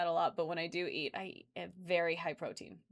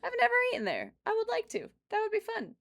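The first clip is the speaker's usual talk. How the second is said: much higher, bright eager voice; excited; 11 semitones higher, 5 dB louder than usual. Retold or imitated. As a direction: squeal it like a giddy thought. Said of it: "I was like".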